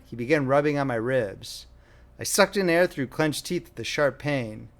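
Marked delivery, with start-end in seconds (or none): none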